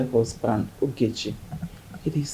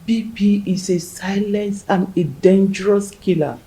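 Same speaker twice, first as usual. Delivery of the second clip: ordinary talk, nothing marked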